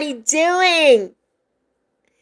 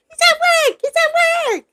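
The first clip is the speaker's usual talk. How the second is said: high squeaky mock voice. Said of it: "I'm like".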